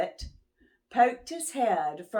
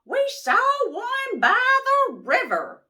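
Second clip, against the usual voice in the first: high, louder nanny goat; goat wife voice; 5 dB louder than usual. Acted. Missus Goat telling Moose about the turkey, high and bright.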